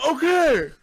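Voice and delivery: high, loud startled okay; startled yelp of okay. Retold or imitated. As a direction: blurt it out, caught off guard. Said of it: "And I'm like".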